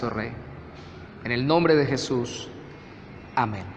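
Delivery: soft, low, reverent prayer. Real, not acted. Pastor thanks God in Jesus' name, soft low prayer. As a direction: pray quietly, letting the voice sink to the amen.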